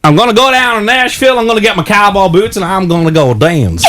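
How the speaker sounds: Southern American accent. Shown as a demonstration. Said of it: "I didn't wanna do the Southern accent".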